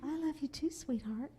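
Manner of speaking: mock tender mother. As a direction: melt a little, answering her child. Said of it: "mother replies to child".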